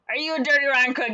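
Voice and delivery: high, fast accusing question; mock accusation. Upset boy interrogating the con man.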